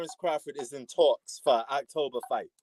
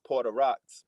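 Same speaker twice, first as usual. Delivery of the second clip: ordinary talk, nothing marked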